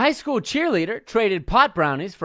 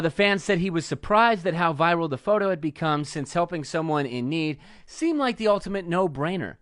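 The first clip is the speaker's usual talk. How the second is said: ordinary talk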